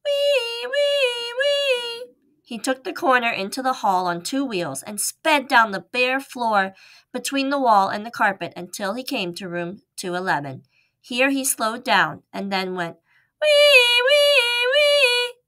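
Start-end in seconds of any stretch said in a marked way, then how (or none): 0.1-2.1 very high siren-like wee. Acted. Mouse imitating a siren. 13.4-15.3 very high, loud siren squeal; loud siren wee. Acted. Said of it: "good and loud".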